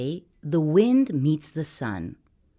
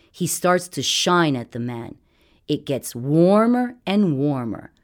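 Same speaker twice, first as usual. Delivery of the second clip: usual speaking voice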